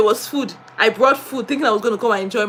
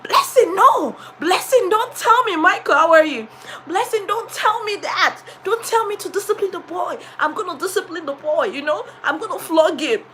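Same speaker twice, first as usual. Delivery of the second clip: director voice; 6 semitones higher than usual. Retold or imitated. Director voiced in a retold story.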